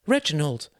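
high-pitched cry of greeting. Acted. Mother greets her son come home, crying out. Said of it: "she cried".

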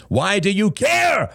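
high, drawn-out angry outburst; thundering. A narrator's reading. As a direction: thunder it, pitch way up and stretched. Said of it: "thundered John".

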